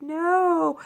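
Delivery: high, drawn-out "no"; retold protest. Kids when mom got MTV disconnected, mock protest. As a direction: wail a mock protest on one long word.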